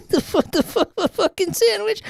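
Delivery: high, helpless laugh through the words; squeaky laughter breaking up speech. Real, not acted. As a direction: crack up mid-phrase. Reading aloud, cracks up laughing.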